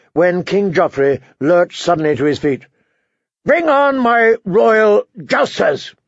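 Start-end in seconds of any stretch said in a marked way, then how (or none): 3.5-6.0 high, slow, wine-thickened royal call; drunken shout. A narrator's reading. Drunk king summons his jousters to the hall, shouting thickly. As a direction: call it out drunkenly, drawing out each word. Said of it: "he shouted, in a voice thick with wine".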